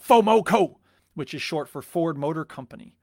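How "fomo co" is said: loud, high rallying chant; imitated shout. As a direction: shout it like a partisan car fan. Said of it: "people who loved Ford would shout".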